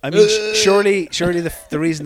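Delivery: loud, high emphatic protest. Real, not acted. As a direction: burst in loudly.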